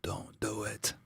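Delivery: breathy whispered warning. Acted. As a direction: barely breathe it out, no voice. Pleading with chat not to.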